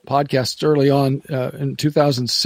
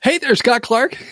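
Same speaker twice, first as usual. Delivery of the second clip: mock bright DJ greeting, pitched up; boss's radio voice; 9 semitones higher than usual. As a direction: lightly imitate the smiling radio boss. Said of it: "and he'd say".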